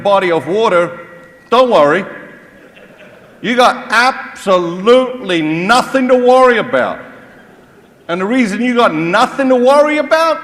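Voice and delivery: spoof a cheerful captain over the intercom; mock pilot announcement. Preacher spoofing a pilot announcing a water landing, hammed up. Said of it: "They say it so calmly".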